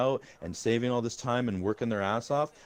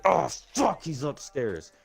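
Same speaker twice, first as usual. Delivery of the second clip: frustrated outburst mid-game, raised pitch; aggravated yell; 6 semitones higher, much slower than usual. Acted. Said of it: "just yelling out how aggravated you are".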